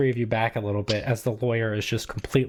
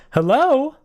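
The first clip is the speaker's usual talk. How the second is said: high, loud, bright-pitched hello; pitched-up "hello?" with a big lift; answering the phone in character; 13 semitones higher, 8 dB louder than usual. Acted. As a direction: pick up as the eager figure on the line. Game master answers as summoned friend.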